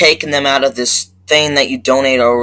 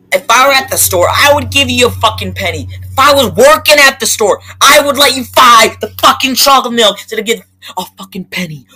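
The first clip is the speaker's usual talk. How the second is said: much higher and louder, rushing at the end; worked-up indignation; heated rant; 10 semitones higher, 3 dB louder than usual. Real, not acted. Ranting to viewers about the gas station clerk, heated indignant rant.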